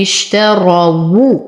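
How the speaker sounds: اشتروا with a heavy راء and rounded واو; slow tajweed demo. Shown as a demonstration. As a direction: say اشتروا slowly with a full راء. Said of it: "أفخم الراء لأنها مفتوحة". (تفخيم الراء مع تلاوة بطيئة)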